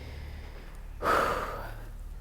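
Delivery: drawn-out soft exhale; long breathy sigh. Real, not acted. Feeling sick in the cell block.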